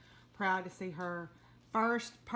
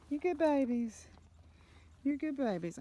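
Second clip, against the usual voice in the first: talking to a dog; 7 semitones higher, 6 dB louder than usual. Real, not acted. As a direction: praise them like babies. Praising the animals.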